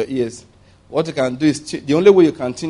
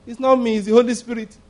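defensive Auntie Mary; 7 semitones higher than usual. Acted. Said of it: "Auntie Mary".

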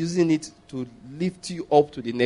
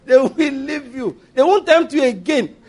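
laughing; 8 semitones higher, 4 dB louder, slower than usual. Real, not acted. Laughing after demo.

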